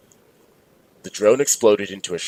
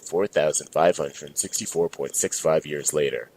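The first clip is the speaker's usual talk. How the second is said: ordinary delivery, nothing marked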